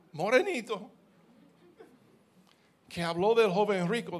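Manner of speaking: preaching. Acted. Preacher telling his congregation about the rich young ruler, fervent. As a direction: lift it up and drive it home to the church.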